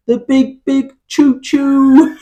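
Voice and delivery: high, drawn-out comic cry; Howard's shout. Retold or imitated. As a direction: stretch it out like a mock panicked warning. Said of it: "it's Howard who shouts".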